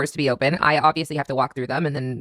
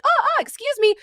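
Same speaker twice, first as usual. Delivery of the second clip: much higher, flustered retold surprise; startled. New mom caught nursing by a stranger, startled and high. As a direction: jump up in pitch, caught off guard. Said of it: "And I'm like".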